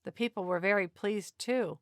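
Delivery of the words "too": ordinary delivery, nothing marked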